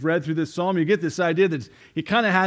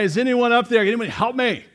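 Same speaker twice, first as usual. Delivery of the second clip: higher, louder call up the cliff; calling for help; 8 semitones higher, 6 dB louder, faster than usual. Retold or imitated. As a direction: yell upward for help. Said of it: "naturally goes to yelling".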